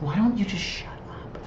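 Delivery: mock-exasperated whisper; hushed, voiceless comeback under the breath; whispered retort. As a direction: whisper it as a retort you wouldn't say aloud. Muttered retort to nagging colleague.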